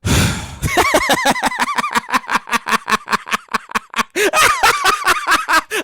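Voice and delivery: long high cackling laugh. Real, not acted. Jay laughing at his own joke, high laugh. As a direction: crack up at yourself. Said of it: "I gotta laugh at my own self".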